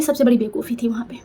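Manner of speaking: hushed admission; whispered regret. Real, not acted. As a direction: whisper it like a confession. Admitting her mistake.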